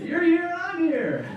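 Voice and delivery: hammed-up chance meeting; fake surprise. Meeting the ex by chance, fake delighted surprise. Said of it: "I'm like".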